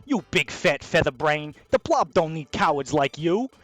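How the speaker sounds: slow, loud gangster boss dressing-down; angry mob boss. Acted. Boss firing a cowardly underling by letter, angry and scornful. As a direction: chew out a useless underling, drawing it out.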